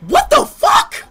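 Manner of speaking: outraged disbelief, drawn out; shocked outburst. Real, not acted. Streamer hears the insult from his opponent, shocked slow shout.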